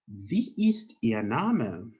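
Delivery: Wie ist Ihr Name said slowly and clearly; slow down so the class can copy; slow model question. Shown as a demonstration. Teacher modelling Wie ist Ihr Name slowly for learners. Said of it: "So this is how you".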